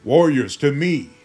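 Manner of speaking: loud rallying call to arms. Acted. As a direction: bellow it across the village. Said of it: "Then comes a call of".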